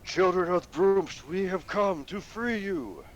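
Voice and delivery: high, slow rallying shout; loud yell. Acted. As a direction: bellow it to the whole horde. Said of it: "as loud as he can yell".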